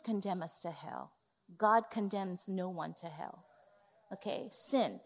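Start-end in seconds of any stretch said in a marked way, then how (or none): none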